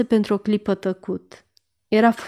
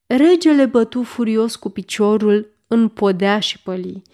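usual speaking voice

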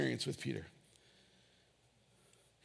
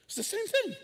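thing said much higher, falling; high squeaky same thing; 14 semitones higher than usual. Real, not acted. Pastor repeating it to the church in a high mock voice. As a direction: pitch it way up in disbelief.

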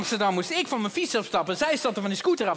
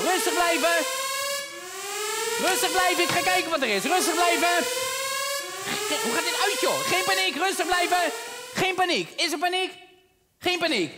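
panicked calling out; 7 semitones higher than usual. Acted. Calling to everyone over the alarm, panicked shouting. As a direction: yell for calm while sounding panicked.

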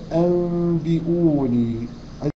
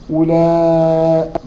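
long held recitation of ولاء, louder; stretched madd; 6 dB louder, much slower than usual. Acted. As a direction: hold the connected madd.

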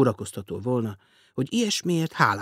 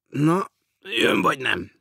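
slow, louder impatient demand; 5 semitones higher, 5 dB louder, slower than usual. A narrator's reading. Guest urges the millionaire to come along, impatient. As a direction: press him, losing patience. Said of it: "kérdezte türelmetlenül".

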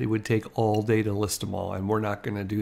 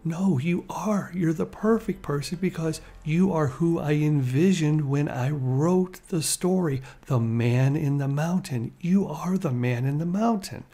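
earnest insistence; 5 semitones higher than usual. Retold or imitated. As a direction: imitate someone insisting he is perfect for it. Retold director persuading reluctant actor.

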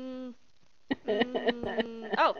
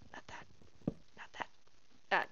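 whispered muttering; 7 dB quieter, slower than usual. Real, not acted. Muttering to self.

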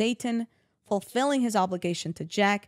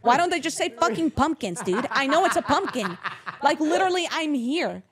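higher and louder, laughing in disbelief; exasperated laughing rant; 7 semitones higher, 6 dB louder than usual. Real, not acted. To her co-host about the strange word, laughing exasperated rant.

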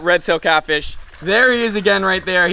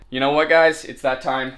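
ordinary talk, nothing marked

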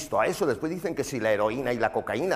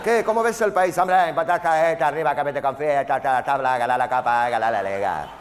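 loud, garbled mumbling footballer answer; dim footballer interview; 2 semitones higher, 6 dB louder than usual. Acted. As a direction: play a footballer babbling nonsense loudly. Mock interview of footballer, gibberish answer.

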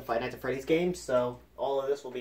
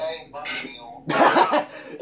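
laughing; 2 semitones higher, 9 dB louder than usual. Real, not acted. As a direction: burst out laughing at the video. Reacting to video with laughter.

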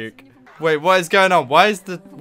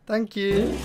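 small, higher-pitched awkward voice; sheepish mock acceptance; awkward thanks; 4 semitones higher, 4 dB quieter than usual. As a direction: act out an awkward reply to a compliment. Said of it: "I'd be a bit more awkward".

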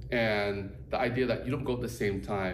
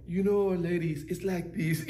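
put-on higher, lightly accented teacher voice; Tomas's accent; 6 semitones higher than usual. Imitating Tomas's accent explaining to the ladies. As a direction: mimic his accent to the ladies. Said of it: "he's like".

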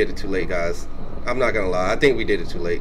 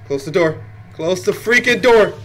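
urgent yell; 5 semitones higher, 6 dB louder than usual. Real, not acted. Urgent escape.

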